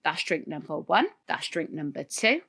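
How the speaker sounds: a hint of a stern father keeping score; disapproving dad. Dad counting his daughter's drinks on Christmas Day, low and dry. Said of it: "my dad was like".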